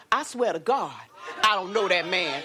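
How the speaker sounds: a hint of Peter swearing he doesn't know him; emphatic oath. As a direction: swear it like you mean it. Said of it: "he said something like this".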